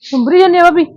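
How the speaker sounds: high, loud question across the yard; loud call. Acted. Loud question to sister-in-law.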